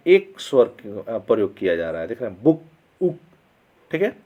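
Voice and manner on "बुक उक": "बुक", then its vowel part "उक" alone; vowel demonstration. Shown as a demonstration. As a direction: isolate the sound "उक" out of "बुक". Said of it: "बुक शब्द का pronunciation कर रहे हैं". ("बुक" में एक स्वर "उक" अलग करके दिखाते हुए)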